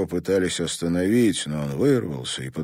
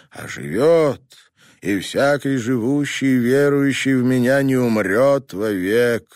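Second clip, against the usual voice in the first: solemn graveside prayer; 8 semitones higher, 5 dB louder than usual. A narrator's reading. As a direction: intone it over the grave like a priest. Priest reading the burial prayer.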